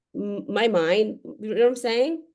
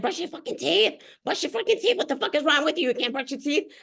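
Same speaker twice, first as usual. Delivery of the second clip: put-on higher, exasperated nagging at a kid; mock yelling parent; 4 semitones higher, faster than usual. Mother yelling at her child about teeth, exasperated mock yell. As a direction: snap at the kid who won't brush. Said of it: "Well, I'm sure you've done it".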